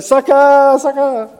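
high, loud, teasing singsong; mock taunt from wife. Wife teasing husband who lost, high sing-song taunt. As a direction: taunt him as the loser. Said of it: "she's like".

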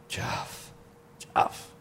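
soft whispered name, twice; gentle whisper. Shown as a demonstration. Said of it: "You weren't yelling at them".

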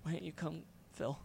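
hushed, hollow half-whisper of a brainwashed man; eerie quiet imitation. Fan imitating brainwashed Talbot's eerie line to friends, hushed. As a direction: mimic the creepy calm plea, barely audible.

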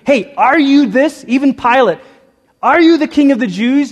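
high, loud challenging question; mock questioning official. People in power loudly challenging Jesus. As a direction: challenge him like a suspicious ruler.

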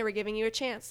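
normal talking voice

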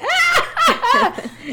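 high, loud imitation cackle; cackling. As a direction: cackle like the family having a time. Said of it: "we just be cackling".

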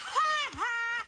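a short high burst of laughter in disbelief; incredulous laugh. Real, not acted. Hearing Chegg's privacy claim, incredulous laugh. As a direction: laugh at the absurdity.

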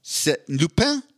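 a drawn-out C'est Lupin with French vowels; mock French accent; French, slowly. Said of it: "I'll give you the, my review en français".

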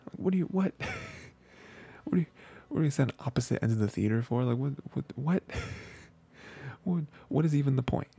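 high, baffled what-what-what with laughter; laughing, can't believe what she's hearing; incredulous disbelief. Real, not acted. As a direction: sputter in amused disbelief. Baffled by the movie-seat idea.

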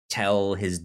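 ordinary talk

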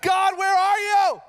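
high, loud, slow mock wail to God; wailing plea. Preacher imitating a frightened believer crying out to God. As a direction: cry out like someone lost in panic. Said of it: "we're, like, crying and weeping".

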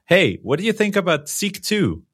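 high-pitched mock office query; imagined colleague's question. Host pretending to ask a co-worker about code, mock-casual and high-pitched. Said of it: "Imagine me trying to ask you".

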